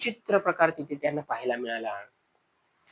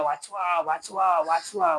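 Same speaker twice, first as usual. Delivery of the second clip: drawn-out mock calls of a drowning man; understated cry for help; much slower than usual. A narrator's reading. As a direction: call for help repeatedly, without really shouting. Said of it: "नंतर तो ओरडू लागला". (मदतीसाठी हलकीशी आरोळी)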